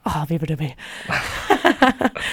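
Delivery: starstruck. Retold or imitated. Starstruck fan sighs singer's name.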